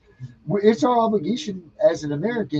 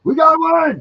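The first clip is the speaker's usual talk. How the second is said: high, loud triumphant cry; excited shout; 12 semitones higher, 8 dB louder, slower than usual. Real, not acted. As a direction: crow it like you just won. Host celebrates, shouted repeat.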